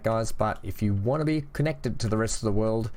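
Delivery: normal talking voice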